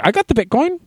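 high put-on smug voice; smug self. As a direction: brag lightly in a silly high voice. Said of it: "I'm like".

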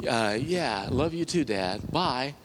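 mumbled imitation of a forced endearment; reluctant, awkward reply. Son told to say he loves his father, acted reluctance.

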